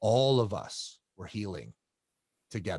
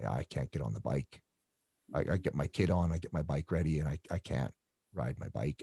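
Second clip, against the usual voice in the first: quiet, defeated; 6 semitones lower, 6 dB quieter than usual. Retold or imitated. A crew friend admitting he can't ride anymore, quiet and defeated. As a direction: lower and softer, a beaten confession. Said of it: "that's when he was like".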